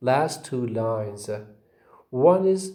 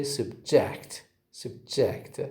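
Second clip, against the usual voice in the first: "subject" said sub-JECT, falling on JECT; weak first syllable, full JECT in "subject"; stress on second syllable; 3 semitones lower, 6 dB quieter, slower than usual. Shown as a demonstration. Teacher repeats the word for the class, "subject" stressed on JECT. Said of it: "stress on the second syllable".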